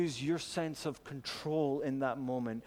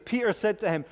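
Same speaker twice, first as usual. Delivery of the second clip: usual speaking voice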